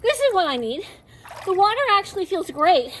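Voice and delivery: panting between words after laps; breathless from swimming; out of breath. Real, not acted. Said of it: "I'm already gassed".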